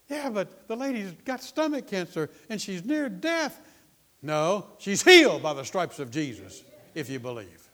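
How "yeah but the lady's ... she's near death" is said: high, loud objection; mock alarmed protest, pitched up; protesting doubter. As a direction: mimic someone insisting it's hopeless.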